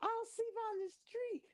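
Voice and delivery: very high mock rant trailing into da da; mock screaming rant. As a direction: squeal her dramatic threat. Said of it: "She's like".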